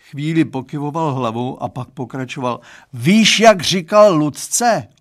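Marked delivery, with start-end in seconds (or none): 2.9-4.9 slow, much higher and louder lament; slow lamenting dad. Acted. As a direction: moan it out in despair. Father laments.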